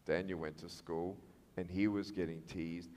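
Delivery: low, soft, intimate narration; hushed storytelling. Real, not acted. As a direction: draw the audience in with a very quiet, moved telling.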